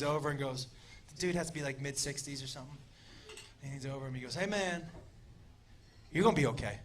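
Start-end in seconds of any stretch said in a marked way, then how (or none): 4.3-6.8 low, soft, with pauses; gentle reassurance. Retold or imitated. Friend leans over man on stretcher, gentle reassurance. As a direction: lean in and comfort him quietly.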